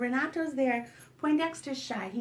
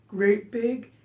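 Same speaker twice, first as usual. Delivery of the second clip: lower, loud big-creature voice; great big spider voice; 3 semitones lower, 5 dB louder than usual. Shown as a demonstration. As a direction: say it big and heavy. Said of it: "my great big spider voice".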